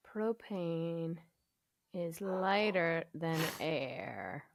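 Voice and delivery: slow, quiet thinking aloud; slow musing. Real, not acted. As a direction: mull it over slowly to yourself. Musing over quiz question.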